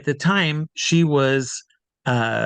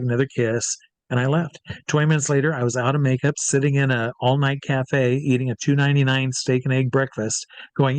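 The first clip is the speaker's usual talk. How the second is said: usual speaking voice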